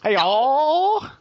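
high, drawn-out mock Texas "Hey, y'all"; mock Texas accent. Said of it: "Texas accent".

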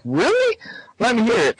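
high mock surprise; surprised. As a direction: shoot way up, amazed. Chucky's retold surprised reply.

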